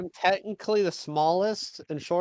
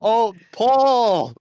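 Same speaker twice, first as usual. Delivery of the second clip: loud, higher cry of a name; shouting; 6 semitones higher, 9 dB louder than usual. Acted. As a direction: yell across the room for him. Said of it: "all shouting".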